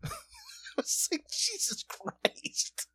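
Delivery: breathy hushed disbelief; whispered. Retold or imitated. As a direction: whisper it in shock. Stunned whispered reaction to story.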